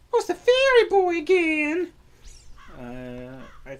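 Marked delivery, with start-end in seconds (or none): 0.1-1.9 put-on high, slow squeaky girl voice; high character voice. Voicing a game character.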